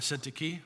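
Syntyche said as SIN-tuh-kee; pronounced ending in KEE. Shown as a demonstration. Preacher offering congregation a name variant, Syntyche ending in "kee". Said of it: "It's all pronounced all three different".

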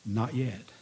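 soft, low, calm denial; quiet refusal. A narrator's reading. Master mouths refusal through oven opening.

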